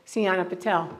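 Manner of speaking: ordinary talk, nothing marked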